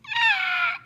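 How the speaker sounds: high short shriek; child's scream. Pretend. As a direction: let out a quick scream. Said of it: "you have to do a scream".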